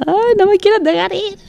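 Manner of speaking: high, whiny near-tears plea, hammed up; mock crying whine. To Nayo, whining about the boss, mock tearful. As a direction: pretend to nearly cry, pitched high. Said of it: "casi llorando".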